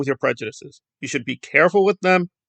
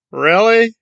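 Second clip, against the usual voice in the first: loud, higher disbelieving question; incredulous; 6 semitones higher, 5 dB louder than usual. Acted. Commentator reacting to the case for a second chance, incredulous. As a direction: throw it out in disbelief. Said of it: "And I'm like".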